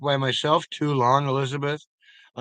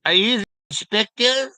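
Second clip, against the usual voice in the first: mock Clouseau question; 8 semitones higher, 6 dB louder than usual. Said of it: "I said".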